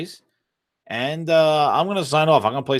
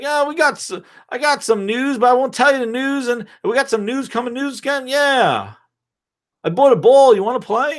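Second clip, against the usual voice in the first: very high, goofy put-on voice; mock Microsoft; 10 semitones higher than usual. Company teasing news to rivals busy fighting, high mock-naive voice. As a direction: babble up high like an oblivious tag-along.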